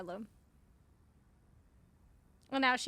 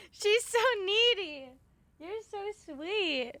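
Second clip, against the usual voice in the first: pet talk; 7 semitones higher, 4 dB louder, slower than usual. Real, not acted. Cooing at cat.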